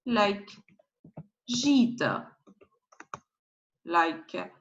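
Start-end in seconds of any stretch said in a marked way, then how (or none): 1.5-2.3 gîte said "zheet"; gîte with zh and long ee; soft French G. Shown as a demonstration. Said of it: "you need to pronounce G".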